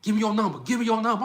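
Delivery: high-pitched, pushy imitation of a forward woman; insistent mock command; bold demand. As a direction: demand it straight out, playfully. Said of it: "They gonna say".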